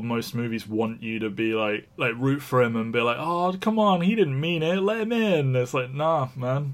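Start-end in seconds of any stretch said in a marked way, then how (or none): 3.2-5.6 high-pitched mock pleading; pleading on his behalf. Voicing viewers pleading for character.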